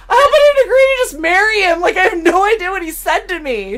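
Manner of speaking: high and fast, cracking with laughter; half-laughing mock alarm; laughing panic. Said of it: "I was like".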